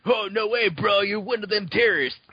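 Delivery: high, dopey surfer-dude voice; surfer bro. Retold or imitated. Co-host spoofs the film's fake American, surfer bro voice. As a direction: play an astonished surfer bro.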